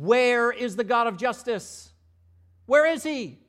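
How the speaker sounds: loud, high, indignant demand; demanding cry. Acted. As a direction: hurl the question at heaven. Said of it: "the Israelites again are saying".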